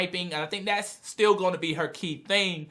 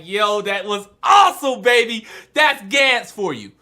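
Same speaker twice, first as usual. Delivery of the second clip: loud, hyped fan praise; excited cheer; 5 dB louder than usual. Retold or imitated. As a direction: whoop it up like a thrilled fan. Fan's cheer at gory moment.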